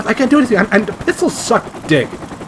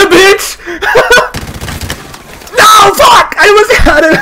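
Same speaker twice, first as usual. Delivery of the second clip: shrieking laughter with high, loud yells; laughing scream; 7 dB louder than usual. Real, not acted. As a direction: lose it, cackling and yelling at once. Gets killed mid-fight.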